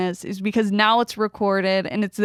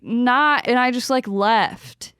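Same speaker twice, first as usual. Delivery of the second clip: usual speaking voice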